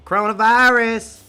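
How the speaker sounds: loud high Coronavirus; mock shout. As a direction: shout it out mockingly. Mocking the word loudly.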